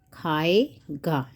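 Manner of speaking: खाएगा stretched out in separate syllables; slow dictation. Shown as a demonstration. Teacher writing the future form, slow dictation. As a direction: draw out खाए, then place गा.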